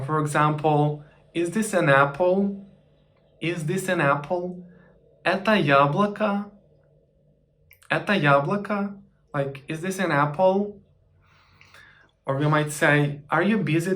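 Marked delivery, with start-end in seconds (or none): 5.2-9.0 «Это яблоко» said higher with a rise on «яблоко»; higher, rising question tune; question intonation. Shown as a demonstration. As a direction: ask «Это яблоко» as a question by raising the pitch. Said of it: "just to change the intonation".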